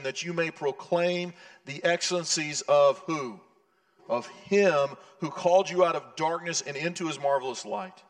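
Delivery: scripture reading. Acted. Reads scripture in full voice.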